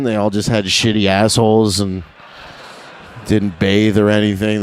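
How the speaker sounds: normal talking voice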